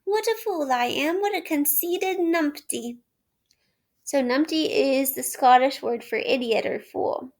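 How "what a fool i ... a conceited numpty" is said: higher, a touch of breathless scolding; self-reproach. A narrator's reading. Toad scolding himself while fleeing. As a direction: berate himself mildly. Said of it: "he gasped as he ran".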